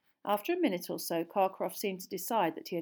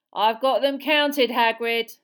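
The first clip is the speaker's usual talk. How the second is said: slow, loud, raised warning; stern; 5 semitones higher, 12 dB louder, slower than usual. A narrator's reading. Keeper warning Hagrid off the eggs, stern. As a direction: warn him off firmly, every word weighted. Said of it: "said Charlie sternly".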